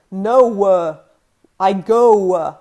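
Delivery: 'go w'의 w 끝소리를 크게 늘여 과장; 입을 크게 움직여 w를 끌기; exaggerated English glide. Shown as a demonstration. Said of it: "I'm emphasizing it for effect".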